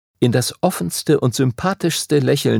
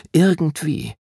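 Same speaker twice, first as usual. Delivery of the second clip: higher anxious; 6 semitones higher than usual. A narrator's reading. Anxious repeated thought.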